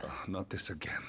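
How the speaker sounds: whispered, exasperated sigh; tired of the same argument, let it out under the breath; soft exasperated whisper; weary whisper. Acted. Husband weary of old argument.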